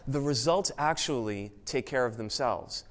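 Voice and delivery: ordinary talk, nothing marked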